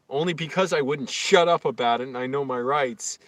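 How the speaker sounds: ordinary talk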